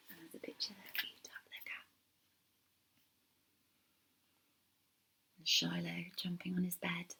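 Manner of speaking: soft whisper to the listener; hushed quick aside. Real, not acted. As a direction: lean in and whisper about the picture. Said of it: "aside whispered to listener".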